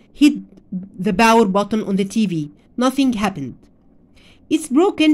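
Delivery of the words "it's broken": higher, excited exclaim. Acted. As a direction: blurt it out in alarm. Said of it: "he exclaimed".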